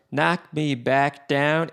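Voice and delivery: "knock me back down" in slow, spaced-out beats; syncopated lyric rhythm. Shown as a demonstration. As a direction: give "knock", "me", "back", "down" each a drawn-out beat. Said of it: "a sort of a syncopated thing".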